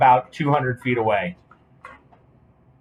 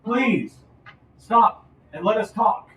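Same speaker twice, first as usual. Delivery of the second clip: high, raised voice calling for parley; king's plea; 9 semitones higher, 5 dB louder, slower than usual. Acted. King asking the party to parley, high insistent plea. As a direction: plead high, as if across a distance. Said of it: "He, he said".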